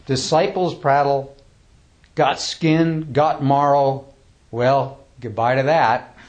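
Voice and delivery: slow, emphatic poem recital; poem reading. Acted. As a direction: declaim the verse, let a chuckle in at the end.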